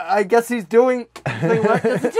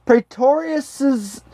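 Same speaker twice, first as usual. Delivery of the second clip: careful slow reading; 7 semitones higher, much slower than usual. Real, not acted. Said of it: "this fucked me up last time".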